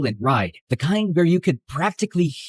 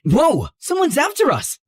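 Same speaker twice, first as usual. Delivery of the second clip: alarmed; 10 semitones higher than usual. Acted. Car rammed from behind.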